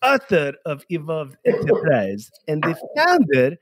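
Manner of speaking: put-on slow, laughing Persian-accented bio read; dad's Persian accent. Host reading guest's bio in his dad's accent, laughing. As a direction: read the bio as your father would. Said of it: "only if you do it in your dad's accent".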